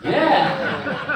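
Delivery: loud, high burst of laughter; laughing. Real, not acted. Laughing out loud after delivering the punchline to the audience. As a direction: laugh hard at your own joke.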